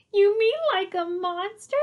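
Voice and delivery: put-on high-pitched little boy's worried question; meek question. A narrator's reading. Tommy to Arthur about the monster, meek. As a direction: ask it high, voiced up as a child. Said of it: "Tommy asked meekly".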